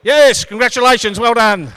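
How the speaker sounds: high, loud cheer; jubilant shout. Real, not acted. Cheering the new citizens after the chant, jubilant. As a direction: congratulate them at the top of your voice.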